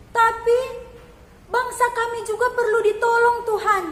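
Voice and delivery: high, loud, insistent pleading; earnest plea. A narrator's reading. Mother answering Jesus, high loud earnest plea. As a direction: press her case with all her heart. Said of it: "dengan sungguh-sungguh".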